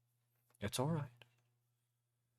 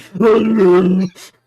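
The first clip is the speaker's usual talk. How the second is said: loud burst of laughter; 7 semitones higher, 20 dB louder than usual. Real, not acted. Laughs after mock threat.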